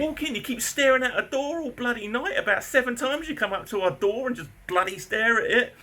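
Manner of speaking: high, heated tirade at the neighbour; angry telling-off. Retold or imitated. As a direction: list his offences in his face. Angrily confronting neighbour.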